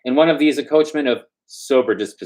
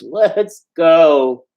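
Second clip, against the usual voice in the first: despairing cry; 7 semitones higher, 5 dB louder than usual. Acted. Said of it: "cried out in a despairing voice".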